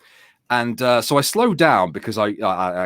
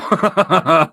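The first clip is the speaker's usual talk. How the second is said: laugh; 7 semitones higher, 4 dB louder than usual. Real, not acted. Laughs at own story.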